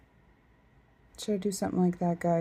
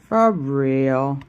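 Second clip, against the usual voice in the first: "for real" blurted much louder, hitting "for"; emphatic; 10 dB louder than usual. Shown as a demonstration. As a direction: punch out "for real" loudly. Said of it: "Did you hear me?".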